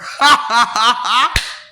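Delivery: short, loud pulsing cackle; mocking cackle. Laughing at the listener's mentality, mocking cackle. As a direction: fire off loud, even bursts of laughter.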